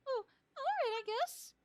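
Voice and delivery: high flustered princess; giddy and flustered, way up high; Princess Peach voice. Acted. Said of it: "my Peach voice".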